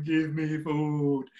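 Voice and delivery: slow, drawn-out monster groan of a plea. Acted. Bakugan monsters begging for food, slow monster voice. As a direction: drag it out like a hungry giant ball.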